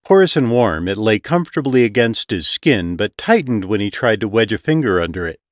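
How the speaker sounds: plain reading voice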